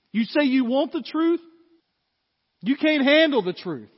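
shouted rebuke. Acted. Pastor as Stephen confronting the council, loud accusation. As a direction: throw it in their faces.

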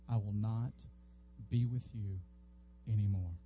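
each phrase weighed, dread in it; solemn warning. Retold or imitated. God to a church that strayed, low and grave. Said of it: "One of the most frightening things".

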